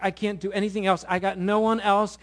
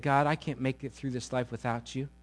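quiet prayer; 4 semitones lower, 9 dB quieter than usual. Acted. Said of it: "Because you said".

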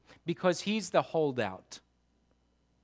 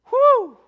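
sharp whew; 13 dB louder than usual. Real, not acted. After acting Thomas.

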